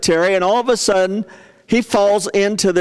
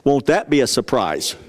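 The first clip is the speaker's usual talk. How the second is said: usual speaking voice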